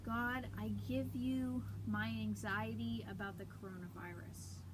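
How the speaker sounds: calm, unhurried meditation voice with long pauses; guided meditation. Acted. Leading viewers through breathing and prayer, guided meditation. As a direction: softly guide them into stillness and prayer.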